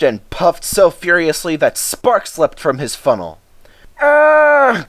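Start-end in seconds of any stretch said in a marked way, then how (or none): 4.0-4.9 high loud groan of strain; squeezed van groaning. Acted. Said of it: "groaned the van".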